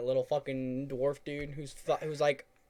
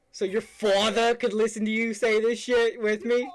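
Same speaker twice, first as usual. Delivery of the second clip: loud teasing; 10 semitones higher, 10 dB louder than usual. Real, not acted. Teasing friend about a duet.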